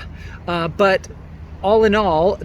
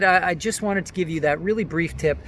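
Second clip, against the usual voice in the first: ordinary talk, nothing marked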